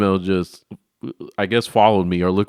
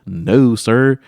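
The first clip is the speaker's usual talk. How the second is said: loud, slow desperate protest; hammed-up loud plea; frantic plea; 5 semitones higher, 6 dB louder, much slower than usual. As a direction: insist hard while begging to leave. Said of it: "Whole time I'm like".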